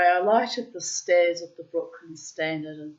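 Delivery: normal talking voice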